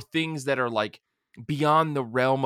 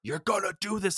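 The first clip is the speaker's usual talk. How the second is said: higher, insistent imitation of a stern order; mock super serious; 6 semitones higher than usual. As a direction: lay down the law, lightly acted. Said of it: "like, super serious".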